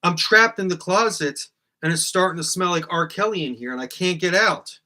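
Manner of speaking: hammed-up trapped-in-a-closet panic; mock panic. Host pretending to be trapped in his closet, yelling in mock panic.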